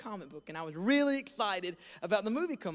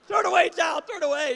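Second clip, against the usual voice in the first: loud, high urgent cry; shrill warning to a child; frantic shout; 9 semitones higher, 10 dB louder than usual. Retold or imitated. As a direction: yell at the kid to look away.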